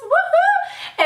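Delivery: high whooping cheer; squealed woohoo; excited cheer. Real, not acted. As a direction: let out a celebratory woohoo. Excited cheer opening the chat.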